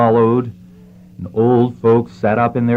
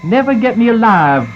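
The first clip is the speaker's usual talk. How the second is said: high, raised cry of defiance; defiant cry; 11 semitones higher, 4 dB louder than usual. Acted. Cornered stranger to armed men below, crying out defiantly. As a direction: cry it out high, refusing to give in. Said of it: "cried the stranger".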